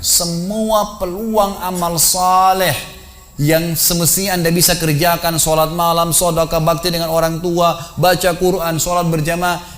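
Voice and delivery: impassioned preaching. Acted. Preacher to man playing a corpse, impassioned exhortation. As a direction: stretch each phrase slowly and press it home.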